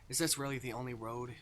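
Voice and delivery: ordinary talk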